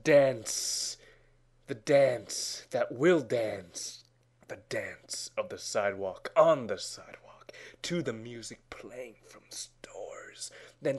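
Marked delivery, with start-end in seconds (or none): none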